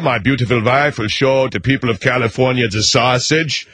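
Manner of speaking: put-on heavy Austrian-German accent as Arnold; pompous governor in a thick Austrian accent; mock Arnold speech in an Austrian accent; Arnold Schwarzenegger impression. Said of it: "enough of the sausage gags, uh, Arnold".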